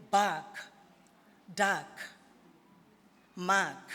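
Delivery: bark, duck, mark singly with long gaps; vowel sound demo. Shown as a demonstration. Teacher giving words with the ah sound, demonstrated singly. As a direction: set "bark, duck, mark" apart to show the ah. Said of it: "in the word".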